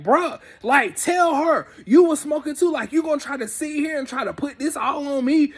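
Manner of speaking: high, indignant pleading, retold; heated mock protest; big mad. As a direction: act out a friend refusing to take all the blame. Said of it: "he was big mad".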